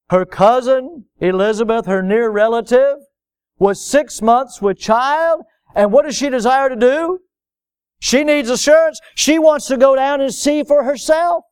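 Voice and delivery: high, ringing preacher's climax; impassioned preaching. Real, not acted. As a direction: preach it out, pitched well above talk.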